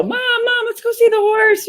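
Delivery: put-on high, eager child begging her mom; excited little girl. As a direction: squeal it like a four-year-old who spotted horses. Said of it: "And I was like".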